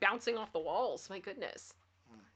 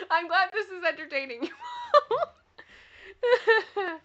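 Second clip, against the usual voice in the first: laughing; 9 semitones higher, 7 dB louder, much slower than usual. Real, not acted. Laughing at snoring dog.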